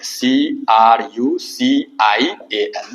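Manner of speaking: each letter drawn out for the class; slow letter-by-letter dictation; slow spelling out. Shown as a demonstration. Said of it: "देखिए".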